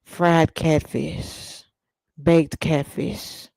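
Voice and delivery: slow, soft seductive food list; hammed-up bedroom voice for catfish; mock sultry. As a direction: purr each dish like a love line. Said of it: "that's sexy talk to me".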